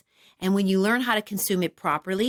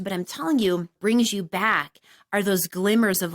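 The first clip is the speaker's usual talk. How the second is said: normal talking voice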